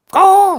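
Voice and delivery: a quick mock crow cry; crow call imitation. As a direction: caw out like an upset crow. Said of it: "he's making his sounds".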